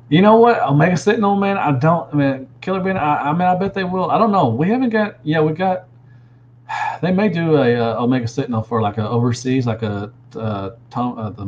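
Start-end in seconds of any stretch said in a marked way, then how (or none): 0.1-5.9 higher and louder, bursting with eagerness; excited. Real, not acted. Streamer to viewers, suddenly excited about Omega Sentinel. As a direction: pitch up, keen about a new figure.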